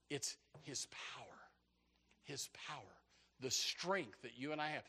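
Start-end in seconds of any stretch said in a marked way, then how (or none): 0.1-2.8 near-whispered, reverent preaching; soft, breath-heavy pulpit hush; hushed emphasis. Real, not acted. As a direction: drop to a hush to let it sink in.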